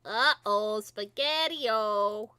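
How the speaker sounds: Spaghetti-O yelled out loud and drawn slow; loud scared uh-oh. Acted. Filly yelling in panic at the smell. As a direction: blurt it out loud in fright.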